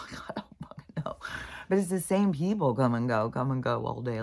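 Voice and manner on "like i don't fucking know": quick, very soft whisper; laugh, then whisper it under your breath; whispered aside. Real, not acted. Admitting to a guess about the neighbors, whispered aside.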